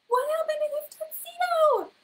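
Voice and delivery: very high, loud shocked question; shocked gasp. Real, not acted. Teacher reacting to the soaked tuxedo in loud shocked surprise. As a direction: gasp in dismay at the ruined suit.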